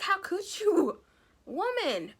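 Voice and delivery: high, outraged cry; how could she, act appalled; squeaky scandalized exclamation; mock outrage. Real, not acted. Reacting to mother's cruel line.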